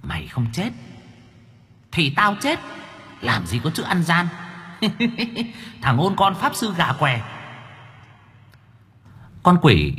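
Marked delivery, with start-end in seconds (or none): 0.0-4.3 demon taunt. A narrator's reading. Demon mocks the boy. 4.8-5.5 high mocking giggle. A narrator's reading. Demon mocks the boy. 5.8-7.2 demon taunt. A narrator's reading. Demon mocks the boy.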